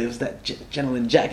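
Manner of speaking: tipsy slur on the words; slurring. Real, not acted. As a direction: slur like after whiskey. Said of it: "I slurred".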